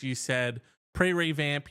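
usual speaking voice

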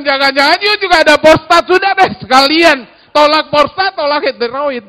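hammed-up exasperated pastor; loud joking reply. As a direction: throw it back with a laugh in it.

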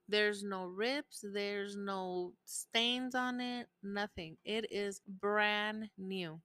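slow, deliberate insisting; slow emphatic. Real, not acted. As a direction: spell it out word by word. Insists charger is new.

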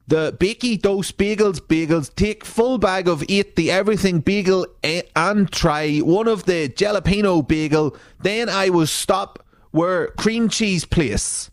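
put-on high, wavering old lady reading her own post; old granny voice. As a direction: play a muddled granny ordering bagels.

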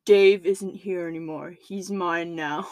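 low, slow possessed voice; demonic voice. Retold or imitated. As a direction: speak low like a possessed man. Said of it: "in the same demonic voice as before".

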